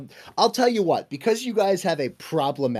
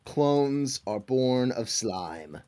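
ominous pronouncement; much slower than usual. Acted. GM pronounces ominous world lore.

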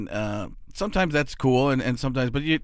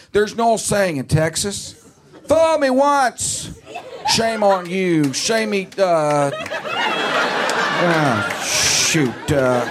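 Bush impression; 3 semitones higher, much slower than usual. Comic imitating the president fumbling the saying, slow exaggerated drawl. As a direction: stretch it out as Bush, losing the line. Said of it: "He's like".

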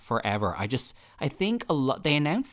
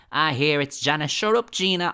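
a hint of Janet in a Dublin accent, snapping; lightly voiced Dublin Janet telling Gina off; 6 semitones higher, 6 dB louder than usual. Podcast host imagines Janet telling Gina to shut up, mock Dublin accent. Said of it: "I could see, like".

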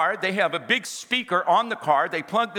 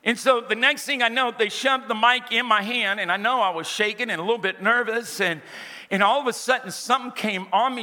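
normal talking voice

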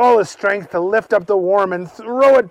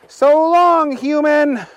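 much higher, louder character goodbye; Willie's farewell; 11 semitones higher, 5 dB louder, slower than usual. Acted. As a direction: send the human off.

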